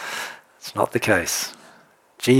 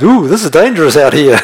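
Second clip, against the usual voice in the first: loud, higher hammed-up alarm; mock fear; 5 semitones higher, 8 dB louder than usual. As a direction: play Peter comically scared of the waves. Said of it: "he was afraid".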